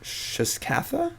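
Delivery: slow, tentative sounding-out, rising at the end; said slowly. Real, not acted. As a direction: sound out Shiskatha slowly, high and questioning. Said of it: "Say it slowly".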